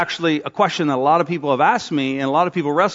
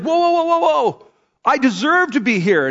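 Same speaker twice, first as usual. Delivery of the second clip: shocked protest; 8 semitones higher than usual. Acted. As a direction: play a leader shocked to be shut out. Religious leaders protesting at judgment.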